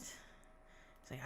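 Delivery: normal talking voice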